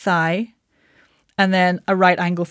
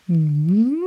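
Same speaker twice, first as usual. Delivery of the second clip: chewy hum. Shown as a demonstration. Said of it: "chewy hum".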